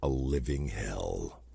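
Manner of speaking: low, slow, spooky repeat of the phrase; mock sinister low drawl; ominous echo. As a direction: drag it out like a doom-laden movie voice.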